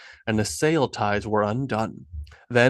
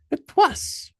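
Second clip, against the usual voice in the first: unsure and much higher, a hesitant reply; tentative. A narrator's reading. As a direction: offer it hesitantly, unsure of himself. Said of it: "Niels said tentatively".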